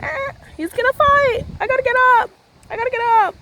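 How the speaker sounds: very high, squeaky mock alarm; imitated squeal. Storyteller as her winded younger self before a fight, high squeal. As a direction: squeak it out as a little panicked exclamation.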